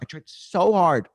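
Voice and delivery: "so" stretched slow and high; stress on SO. Acted. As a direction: lean long and high on "so". Emphatic repeat of exasperated confession.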